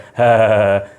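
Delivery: a low, loud braying laugh put on for show; horse-like laugh. Shown as a demonstration. Teacher demonstrates a horse-like laugh to the class, braying. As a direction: bray it out like a silly horse. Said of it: "घोड़े की तरह हंस रहे हो".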